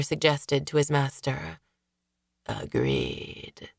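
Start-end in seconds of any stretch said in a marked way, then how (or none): 2.4-3.7 deep, low husky rasp; husky voice from a corpse's lungs. Acted. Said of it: "said the husky voice".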